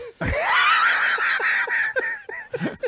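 big high shrieking laugh. Real, not acted. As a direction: burst out cackling. Big laugh after joke.

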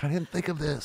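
high, small sheepish voice; mock sheepish admission. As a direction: concede it like a dim critic.

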